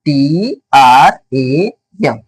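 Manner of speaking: टी आर ए एम read letter by letter, very slowly; letters spelled out slowly. Shown as a demonstration. Teacher spelling टी आर ए एम slowly for students. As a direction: space out टी आर ए एम letter by letter. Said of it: "क्या बन जाएगा English में?".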